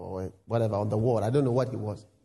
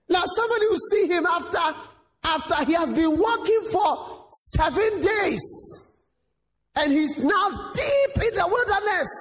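building preaching shout; 9 semitones higher than usual. Real, not acted. Preacher building to a cry about Jesus in the wilderness, shouting. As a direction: build it up until you're calling it out.

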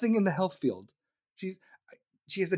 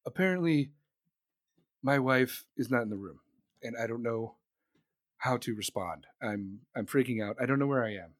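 put-on low, hesitant, confused old president; softly lost, trailing between phrases; Biden impression; 8 semitones lower, 3 dB quieter than usual. Acting confused Biden, phone call.

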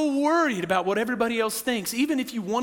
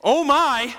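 awed exclamation; 5 semitones higher, 6 dB louder than usual. Retold or imitated. Elders awestruck, repeated.

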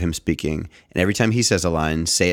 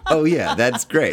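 dynamic showman voice; 7 semitones higher, 3 dB louder, slower than usual. Retold or imitated. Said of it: "And it's like".

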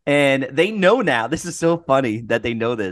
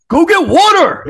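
much higher and louder annoyed yell; mad yell; 9 dB louder than usual. Retold or imitated. Coworker yelling at the morning host to get water, mock angry. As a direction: snap it out, fed up with the coughing. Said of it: "he would get so mad".

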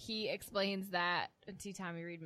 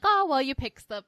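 lightly voiced quote; 6 semitones higher, 10 dB louder than usual. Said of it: "she's like".

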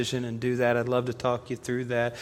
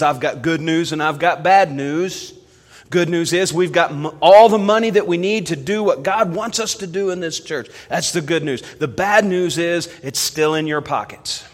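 higher, louder pulpit announcement; preacher's announcement; 5 semitones higher, 8 dB louder than usual. Retold or imitated. Preacher giving his church good and bad news, mock pulpit announcement. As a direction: announce it to a whole congregation. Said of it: "the preacher who stood up in church".

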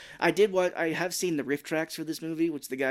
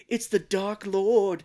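slow, higher mock-dramatic announcement; ominous warning; 7 semitones higher, 5 dB louder, much slower than usual. Friend retelling a movie, hamming up an ominous warning. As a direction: ham up a horror-movie warning.

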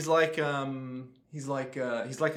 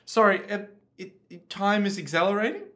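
higher, halting query; baffled mock contestant checking the rules; bewildered contestant; 5 semitones higher than usual. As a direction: stammer into a puzzled question. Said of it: "Looks over to rapidly, like, decaying Alex Horn".

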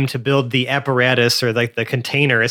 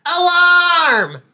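high shrill alarm cry; alarm shout; 4 dB louder, much slower than usual. Acted. Character raising the alarm.

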